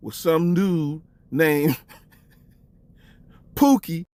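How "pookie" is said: ordinary talk, nothing marked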